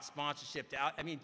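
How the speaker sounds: ordinary delivery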